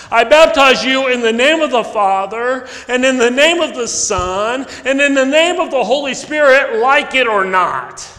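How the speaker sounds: solemn rite with a defiant tail; baptismal formula. Shown as a demonstration. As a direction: intone it like a baptism at the font. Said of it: "add four words to our service of baptism".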